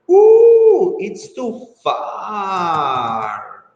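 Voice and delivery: high ooh then slow drawn-out too far; mock dismay. Shown as a demonstration. Teacher acting dismayed at a faraway exam, mock dismay. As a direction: act shocked the exam is so far off.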